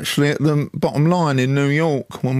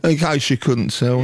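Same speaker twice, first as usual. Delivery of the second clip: put-on Essex accent. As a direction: say it in a light Essex voice. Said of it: "my Essex accent".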